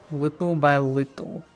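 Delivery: slow, slightly put-on Russian accent. Real, not acted. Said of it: "said that in a Russian accent".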